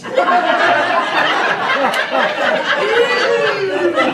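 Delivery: big high burst of laughter; high, hearty laugh. Real, not acted. Laughs at his own joke.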